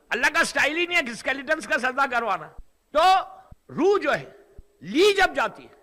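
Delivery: normal talking voice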